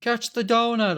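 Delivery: mock warning yell, pitched high and drawn out; high, stretched heads-up call; imagined yell. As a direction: call it out high, as if throwing something. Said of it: "at least yelled at me".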